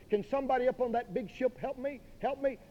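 put-on high, soft plea in an old lady's part; drowning old lady. Preacher plays a decrepit lady calling to the ship, high plea. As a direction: call up weakly for help, pitched high. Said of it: "Here's an old, decrepit lady".

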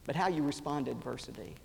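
ordinary delivery

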